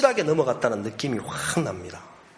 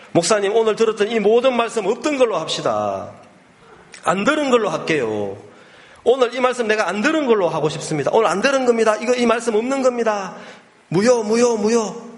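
high-pitched, insistent imitation of a protesting friend; acted frantic retraction; 9 semitones higher than usual. Friend begging pastor to void the talk, acted insistence. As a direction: call it all off, voice pitched well up.

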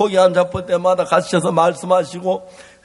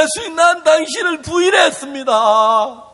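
anguished confession; 7 semitones higher than usual. Acted. Peter's anguished confession acted out.

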